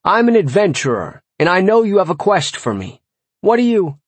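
high-pitched bold announcement; brash hail, rising until cut off; calling out. Acted. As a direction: announce yourself to the farmer. Yelling to farmer across field.

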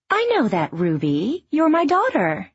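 put-on mother setting her child straight; lightly voiced mother, calm; patient mother. A narrator's reading. Mother answers young Ruby.

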